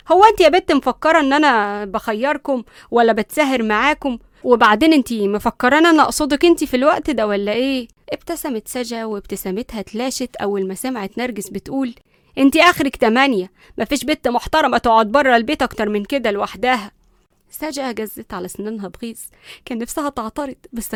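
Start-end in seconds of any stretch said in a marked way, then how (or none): 0.1-7.9 loud, bossy granny scolding; indignant grandmother, forceful; stern grandmother. A narrator's reading. 12.4-16.9 loud, bossy granny scolding; indignant grandmother, forceful; stern grandmother. A narrator's reading. Grandmother sets curfew.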